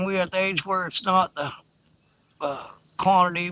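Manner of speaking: usual speaking voice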